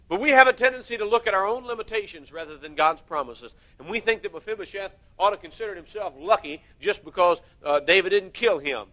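loud, high preaching to the congregation; fiery preaching. Acted. Preacher urging the congregation to look at God's promises, loud and driving. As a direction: hammer it home from the pulpit.

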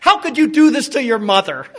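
loud, wounded guilt trip; scolding mother. Retold or imitated. Preacher's mother to her son, loudly reproachful. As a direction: imitate a mom crying out in reproach. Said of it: "I can hear my own mother saying".

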